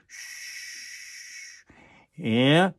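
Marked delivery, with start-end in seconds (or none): 0.1-1.6 quiet unvoiced shh; soft shushing. Shown as a demonstration. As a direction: gently hush it away. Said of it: "you go like this".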